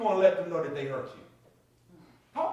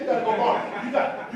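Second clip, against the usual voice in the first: normal talking voice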